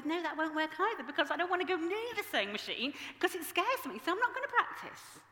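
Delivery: whiny refusal. Retold or imitated. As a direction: protest like a scared child. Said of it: "I go".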